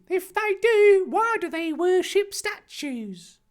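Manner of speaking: high, piping, drawn-out voice; questioning Harry. Acted. Harry pressing Dumbledore about statues, insistently. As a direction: press the point in a squeaky voice.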